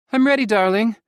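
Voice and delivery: high, loud mother's voice; mother calling down the stairs, eager; mother voiced. A narrator's reading. Mother ready to leave, high and bright.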